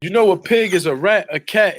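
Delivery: ordinary talk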